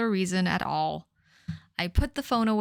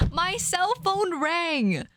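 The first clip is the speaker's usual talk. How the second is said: high, slow, exaggerated reading; slow, high, overdone announcement; dramatic; 9 semitones higher, much slower than usual. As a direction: ham up the line like a melodrama. Dramatic reread of line.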